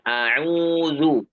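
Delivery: slow a'udzu with an even 'u'; unchanged long vowel. Shown as a demonstration. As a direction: recite a'udzu steadily as a model. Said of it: "tetap mengambil pendapat yang". (contoh a'udzu yang tetap, pelan)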